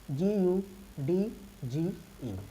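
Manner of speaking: slow, letter by letter; spelling out. Shown as a demonstration. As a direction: spell it slowly with gaps. Spelling out the example word. (एक-एक अक्षर धीरे-धीरे बोलते हुए)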